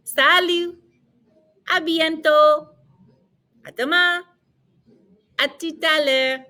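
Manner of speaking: high, evenly held French drill; repeat-after-me model. Shown as a demonstration. Teacher reviews goodbyes with learners, high and measured. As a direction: sing out each goodbye for copying. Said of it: "Let's go through those goodbyes again".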